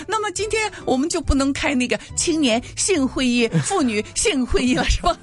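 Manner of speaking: mock student joke. Tease in a lifted, joking voice. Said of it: "自己听了以后也挺笑的".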